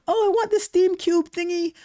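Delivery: high-pitched mock whine; mock crying gamer. Host teasing viewer about crying over a price, mock whining. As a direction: imitate a whiny kid begging for a toy. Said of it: "that you were crying about".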